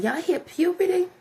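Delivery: high, rising pitch; voice going up. Shown as a demonstration. Speaker showing boys how voices change at puberty, high pitched. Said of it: "y'all goes up".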